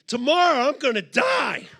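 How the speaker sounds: high, loud comic dread; hammed-up doomed cry; mock panic. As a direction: shout it in exaggerated alarm. Mock panic at hiking plan.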